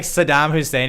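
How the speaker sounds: normal talking voice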